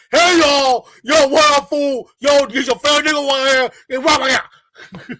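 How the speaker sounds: high, loud shouting hustler calling tourists over; mock loud street hustler. Mocking the tour guide, a loud high shouted hustler pitch. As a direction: belt it out like a guy hyping tourists.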